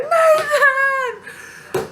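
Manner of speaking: high, drawn-out wail for help; hammed-up panicked call to husband; exaggerated scream. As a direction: cry out the name in mock panic. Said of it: "it wasn't like that".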